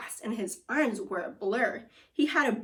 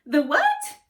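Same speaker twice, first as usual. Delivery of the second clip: surprised question; 5 semitones higher, 8 dB louder than usual. Acted. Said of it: "Huey said".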